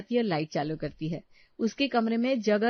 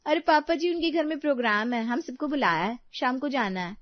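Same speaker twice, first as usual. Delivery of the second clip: put-on high, lively young-woman role; light, higher-pitched family voice; eager daughter-in-law; 6 semitones higher than usual. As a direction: explain the invitation to father-in-law eagerly.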